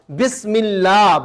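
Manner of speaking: slow, careful بسم with a clean س; slow demonstration. Shown as a demonstration. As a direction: stretch out بسم اللہ to show the س. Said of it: "یہ بسم اللہ والا سین ہے".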